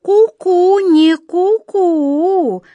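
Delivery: high, loud, long-drawn cuckoo riddle; mysterious cuckoo. Acted. Cuckoo answering about the key, mysteriously. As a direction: stretch the final call enigmatically. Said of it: "загадочно покачала головой кукушка".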